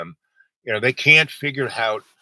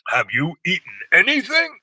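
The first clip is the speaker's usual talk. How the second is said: higher, slightly louder officious query; lightly hammed-up anesthetist asking sharply; mock stern nurse question; 8 semitones higher, 5 dB louder than usual. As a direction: play the scolding staffer, pitched up. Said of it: "the guy who's gonna stick the needle in my arm goes".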